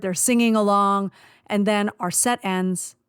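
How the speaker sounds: ordinary talk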